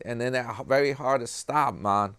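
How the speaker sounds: ordinary talk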